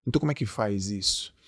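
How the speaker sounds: soft dismay. Retold or imitated. The viewer reacting to his advice, soft dismay. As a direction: mutter it under the breath, overwhelmed. Aí você vai falar assim.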